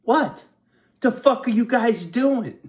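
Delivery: high, loud taunting shout; mock yell at cop. Shouting at a cop driving by, angry taunt. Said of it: "I'm like".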